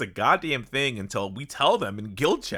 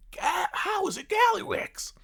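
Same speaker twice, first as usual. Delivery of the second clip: shocked goblin shout. Retold or imitated. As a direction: squeal it out in shock. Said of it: "when he was like".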